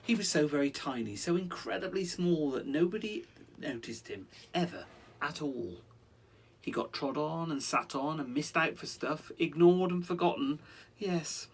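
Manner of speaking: lively rhyming read-aloud; story narration. A narrator's reading. Parent reading a rhyming story to a child. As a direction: read it like a bedtime picture book.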